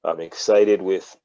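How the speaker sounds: ordinary delivery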